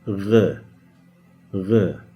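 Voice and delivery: R rolled in the back of the throat as in French; R gargled from the throat. Shown as a demonstration. Teacher demonstrating the ghain as a French R. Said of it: "how the French pronounce their R letter".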